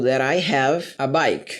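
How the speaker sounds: slow, careful English example; slow model sentence. Shown as a demonstration. Teacher reading an example to students, slow and clear. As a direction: spell it out slowly for students. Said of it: "eu tenho o costume de falar".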